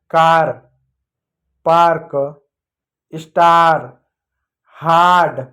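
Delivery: कार, पार्क, स्टार, हार्ड with a loud, long आ; long आ sound. Shown as a demonstration. Teacher showing children the आ sound, long loud आ in कार, पार्क, स्टार, हार्ड. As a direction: read out कार, पार्क, स्टार, हार्ड singly, stressing each आ. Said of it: "आ का मात्रा पढ़ते हैं".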